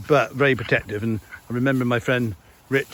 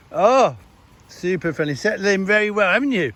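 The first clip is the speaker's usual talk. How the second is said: praising the dog; 8 semitones higher, 4 dB louder than usual. Real, not acted.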